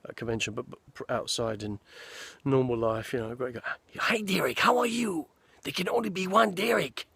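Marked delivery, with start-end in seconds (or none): 4.0-7.1 higher, put-on Lambert voice; Christopher Lambert impression. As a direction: mimic the star signing to a fan. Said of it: "Christopher Lambert".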